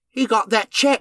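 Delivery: put-on lightly done Cockney accent with a laugh; mock Cockney accent. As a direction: say "He got that check" like a cartoon Cockney.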